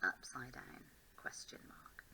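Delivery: slow, soft whisper of Upside down question mark; whispered reminder. Shown as a demonstration. Teacher whispering her target to herself, slow and hushed. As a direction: breathe Upside down question mark like a secret note to self. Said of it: "That's my target".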